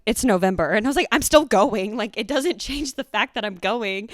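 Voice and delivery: indignant retort. Retold or imitated. Retelling her excuse to the boyfriend about college, indignant. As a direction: insist loudly, imitating an argument. Retold breakup argument, raised voice.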